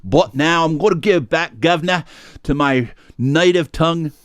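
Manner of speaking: put-on a playful Australian pirate accent, half-hearted and grinning; mock Australian accent. Switching back to his "native tongue" for the co-host, mock Australian accent. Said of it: "which has apparently turned Australian".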